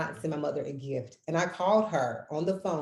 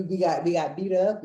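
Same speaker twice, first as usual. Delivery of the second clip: usual speaking voice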